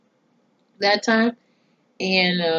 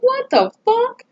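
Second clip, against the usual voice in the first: high, slow, stretched-out disbelief; incredulous reaction; slower than usual. Retold or imitated. Viewer reacting to a neighbor stealing silverware, high incredulous drawl. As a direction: draw it out high, baffled by the nerve.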